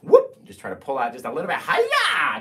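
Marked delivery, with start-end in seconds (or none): 0.0-0.4 high, loud playful whoop. Real, not acted. Playful exclamation with brush. 1.6-2.4 mock karate cry. Playful karate cry with knife.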